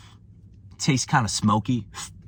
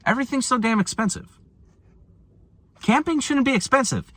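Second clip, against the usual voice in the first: exasperated rant; 11 semitones higher than usual. Real, not acted. Ranting about paying to camp.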